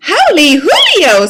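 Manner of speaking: high, loud squeal of surprise. A narrator's reading. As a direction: squeal it out in delight. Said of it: "squealed the kitty boy".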